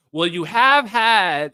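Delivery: loud, slow accusing interjection; Bernie. Retold or imitated. Streamer imitating Bernie talking over Jack, accusing. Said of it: "Bernie comes in and goes".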